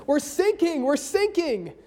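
lightly voiced frantic sailor; mock panic. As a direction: cry for help from a sinking ship. Sailor repeating call.

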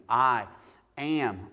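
"I" loud, a pause, then "am" alone; word-by-word stress. Shown as a demonstration. Preacher breaking a verse down word by word, "I" and "am" each stressed. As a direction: separate "I" and "am" with a clear break. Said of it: "each word one at a time".

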